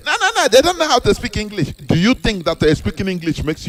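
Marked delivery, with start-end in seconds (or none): 0.1-1.7 high, fast dismissive put-on; mock scorn. Mocking people who look down on non-English speakers, high and fast. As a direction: toss it off like a sneering snob.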